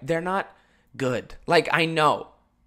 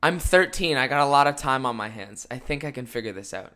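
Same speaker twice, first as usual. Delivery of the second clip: ordinary delivery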